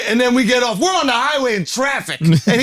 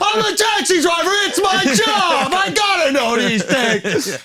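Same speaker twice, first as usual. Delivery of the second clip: high, stretched-out defensive yelling; hammed-up exasperated protest; mock frantic shouting; 9 semitones higher, slower than usual. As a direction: imitate a frazzled driver yelling back. Frazzled driver defends himself, acted.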